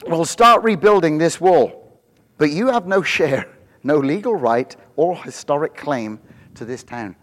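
high, loud, rousing sermon delivery; rally the congregation with rising fervor; full-force preaching. Acted.

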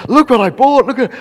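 high, loud show-off squeal; excited daughter. Retold or imitated. Daughter home from the mall, high excited boast. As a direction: show it off, thrilled. Acts daughter showing off purchase.

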